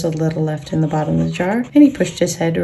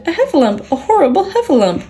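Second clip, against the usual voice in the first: terrified Piglet; 9 semitones higher, faster than usual. A narrator's reading. Piglet flees the trap, crying out in high panic. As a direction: gabble it out in sheer terror. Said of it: "cried Piglet".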